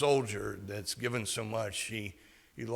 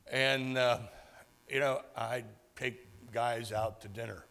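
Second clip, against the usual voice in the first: normal talking voice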